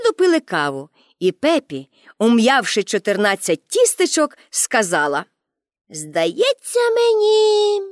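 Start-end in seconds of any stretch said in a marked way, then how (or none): 5.9-7.8 put-on high, slow musing child voice; musing girl. A narrator's reading. Pippi reflects after her fourteen biscuits, musing girl. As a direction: draw it out high, pondering aloud.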